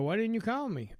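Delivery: a hammed-up high whine of reproach; mock hurt woman. A woman met on the street scolds him, mock high whine. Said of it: "they see you on the street and they go".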